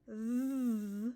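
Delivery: "Z" buzzed long and loud; sound demo. Shown as a demonstration. Teacher modelling "Z" to young readers, buzzing. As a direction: hold the "Z" buzz steadily. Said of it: "you say it like this".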